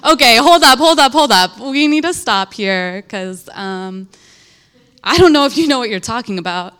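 loud, mock-outraged "hold up" with a fast finish; a young believer loudly halting her teacher mid-lesson, mock indignant; mock indignant outburst. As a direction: play yourself cutting the teacher off in a retold huff. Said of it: "And I was like".

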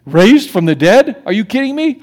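incredulous sneer; mocking Greek scoff. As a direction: ridicule the idea. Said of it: "The Greeks".

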